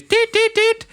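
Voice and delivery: high, even imitated beeps; machine beeps. Swab machine at security sounding its alert, in high beeps. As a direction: mimic a scanner going off. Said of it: "the machine goes".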